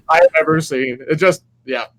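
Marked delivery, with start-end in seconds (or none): none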